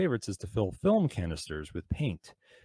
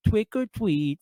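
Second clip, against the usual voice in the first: put-on high-pitched lisping child, R's turned to W's; mock a god-awful little trick-or-treater; little kid; 11 semitones higher, 4 dB louder, slower than usual. Mimicking kids at the door, in a high little-kid voice. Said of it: "hear that god-awful "Twick or tweet" from some little kid".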